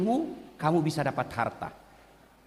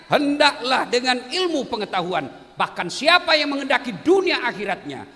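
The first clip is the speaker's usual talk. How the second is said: preaching; 6 semitones higher, 4 dB louder than usual. Acted. Preaching at full force.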